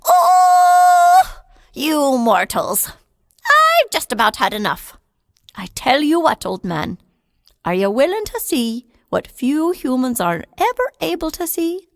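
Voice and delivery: loud high cry then firm scolding; the fairy runs out of patience; fed-up little man; exasperated wee man. Acted.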